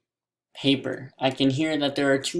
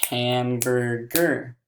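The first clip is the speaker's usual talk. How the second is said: HAMBURGER slowly in three beats, ham-bur-ger; slow, separated HAMBURGER; split into syllables; much slower than usual. Shown as a demonstration. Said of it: "how many syllable sounds was that".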